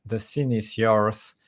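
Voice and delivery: normal talking voice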